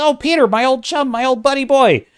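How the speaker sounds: mock chummy Hook. Reviewer mimics Hook greeting Peter, high mock chumminess. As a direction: play up a falsely cheery old pal. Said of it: "and he says".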